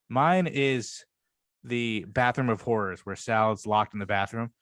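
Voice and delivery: ordinary talk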